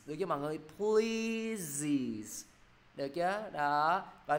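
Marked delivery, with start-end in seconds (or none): 0.8-2.5 "Pleases" stretched long, high first syllable then falling; plural iz ending. Shown as a demonstration. Teacher repeats for the class, slow drawn-out "Pleases". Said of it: "thêm S vào đọc là gì?".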